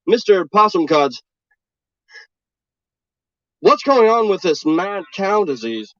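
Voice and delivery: mock local TV news reporter voice. Parody reporter introducing the mad cow story on air, mock news read. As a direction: play a deadpan field reporter.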